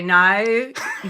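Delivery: a quick Australian "No, no." with a lightly put-on Australian accent. Shown as a demonstration. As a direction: try your best Australian accent on "No, no.". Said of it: "I, I can say".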